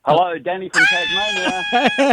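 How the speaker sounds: big, high, drawn-out hello; radio host calling out his hello; excited radio greeting. Real, not acted. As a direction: greet them like an on-air host.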